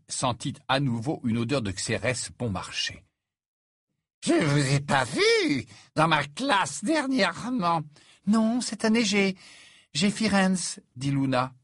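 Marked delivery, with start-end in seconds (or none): 4.2-7.8 put-on high, slow drunken remark; tipsy professor. Tipsy Trelawney addresses Luna. 8.3-10.8 high, light student voice; polite schoolgirl. Acted. Narrator voices Luna answering politely.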